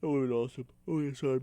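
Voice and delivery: talking mid-yawn; words stretched by a yawn. Real, not acted. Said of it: "I'm yawning".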